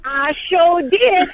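high, drawn-out audition line; imitated daughter rehearsing. Mother imitates her daughter rehearsing for Roots, high slow delivery. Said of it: "She's like".

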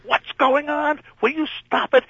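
high, panicked rant; a boss in full alarm over his star walking off; high-pitched frantic scolding. Retold or imitated. Boss storms in again.

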